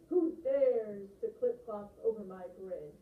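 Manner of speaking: loud, slow troll challenge; fuming troll. Acted. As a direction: thunder it slowly as an angry troll. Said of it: "fumed the troll".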